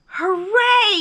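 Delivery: high, loud cheer; yelled. A narrator's reading. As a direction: cheer at full voice. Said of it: "yelled Bear".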